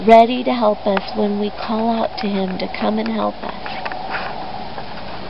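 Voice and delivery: usual speaking voice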